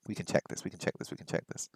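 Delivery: unvoiced, rapid whisper to herself. Real, not acted. Learner whispers to herself while checking the translation, hushed. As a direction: murmur it under your breath while you look it up.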